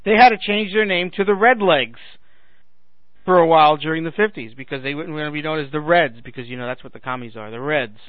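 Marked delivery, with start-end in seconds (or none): none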